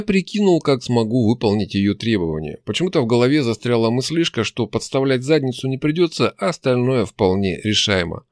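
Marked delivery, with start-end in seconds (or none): none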